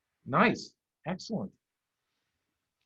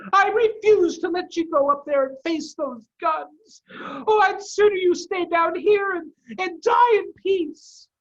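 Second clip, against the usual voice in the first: very high, loud desperate mother fox; Missus Fox refusing; 9 dB louder than usual. Acted. Mrs Fox refuses to let cubs face guns, loud. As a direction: forbid it, near a cry.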